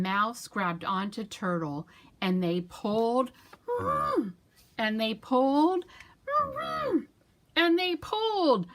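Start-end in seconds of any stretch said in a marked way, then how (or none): 2.2-8.7 rising to a loud shout; louder and higher each pull; building pull. Acted. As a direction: build to a big final heave. Final big pull.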